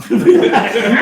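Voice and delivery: big high burst of laughter; loud high laugh. Real, not acted. Guest laughing at his own punchline, loud high laugh. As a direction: crack up loudly.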